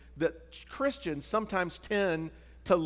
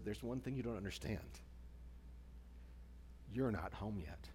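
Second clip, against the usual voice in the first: gentle correction; 6 semitones lower, 14 dB quieter than usual. Retold or imitated. Friend's gentle answer.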